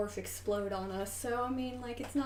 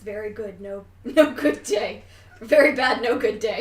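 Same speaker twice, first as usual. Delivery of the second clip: louder, laughing through a mock doom line; 12 dB louder than usual. Real, not acted. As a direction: cackle out the bad news. GM declares a bad day.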